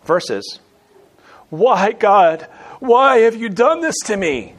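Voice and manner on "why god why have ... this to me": loud, high, indignant demand; accusing God. Shown as a demonstration. Believer blaming God for suffering, loud and accusing. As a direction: cry out the why as an accusation. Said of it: "Same words, different tone".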